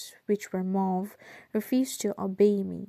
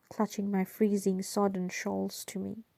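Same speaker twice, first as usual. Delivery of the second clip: usual speaking voice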